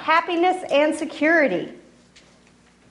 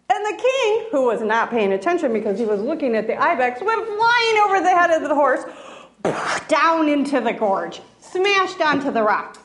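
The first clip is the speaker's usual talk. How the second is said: ordinary delivery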